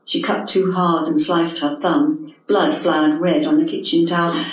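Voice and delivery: plain reading voice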